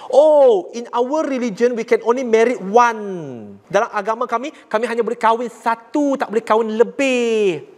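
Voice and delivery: high, slow sneering imitation of the religious leader; mocking quote. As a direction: mimic a snide jab. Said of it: "ketua agama dia kutuk Islam, sindir Islam".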